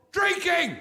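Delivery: Drinking flung out high and loud; gleeful shout of the answer; triumphant punchline. Acted. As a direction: announce it like a grand reveal. Punchline of drinking game joke.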